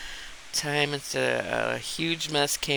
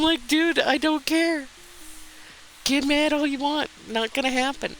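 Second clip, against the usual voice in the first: much higher, sing-song brush-off; mocking dismissal; 12 semitones higher than usual. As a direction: toss it off lightly, mock-carefree. Mock quote to angry customer.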